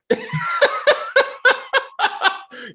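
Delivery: real high-pitched burst of laughter; big laugh. Real, not acted. Podcaster cracking up after his character bit, long high laugh. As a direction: crack up at your own joke.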